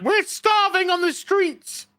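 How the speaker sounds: high, pleading beggar's rant; hammed-up angry peasant; mock peasant rant. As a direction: whine it like a starving man. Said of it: "He's just like".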